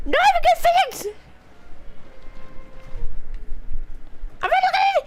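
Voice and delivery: frantic high rant. Real, not acted. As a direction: scream the complaints in a high, breaking rage. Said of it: "I love being absolutely unintelligible".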